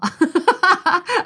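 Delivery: sudden high laugh breaking out; high burst of laughter. Real, not acted. Laughing at her own joke.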